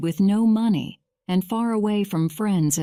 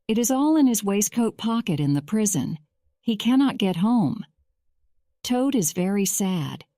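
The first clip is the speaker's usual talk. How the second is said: ordinary delivery, nothing marked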